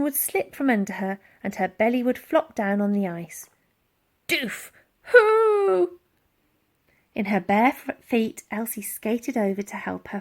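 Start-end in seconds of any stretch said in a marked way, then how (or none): 4.3-6.0 high, loud, strained mammoth hoot; a big beast grunting as it falls; mammoth groan. A narrator's reading. Mammoth slipping on ice.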